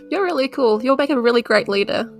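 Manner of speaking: ordinary delivery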